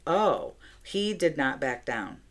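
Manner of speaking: ordinary talk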